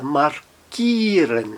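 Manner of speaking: markieren with a stretched "ee": "mar-KEE-ren"; long I. Shown as a demonstration. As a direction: hold the IE in markieren as one long "ee". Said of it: "um I um pouco mais alongado".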